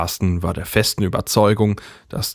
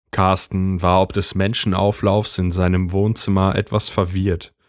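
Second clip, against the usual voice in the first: ordinary delivery, nothing marked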